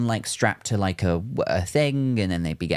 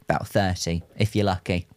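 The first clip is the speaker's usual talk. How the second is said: ordinary talk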